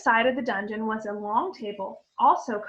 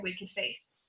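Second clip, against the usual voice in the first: ordinary delivery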